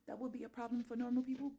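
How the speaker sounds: ordinary delivery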